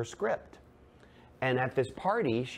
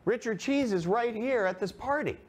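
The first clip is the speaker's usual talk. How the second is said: high, carrying call from afar; calling out across table; 12 semitones higher, 3 dB louder than usual. Retold or imitated. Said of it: "someone at the other end of the table says".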